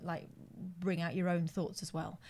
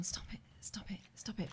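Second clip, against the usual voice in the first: whispered, much softer pleading; retold whisper; 12 dB quieter than usual. Wife in passenger seat, whispering stop it. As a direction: hiss it under your breath. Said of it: "I'm going".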